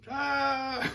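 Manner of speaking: loud, high, drawn-out mock scream; hammed-up yell held on a high note. Tony suddenly stuck to the wall, high mock scream.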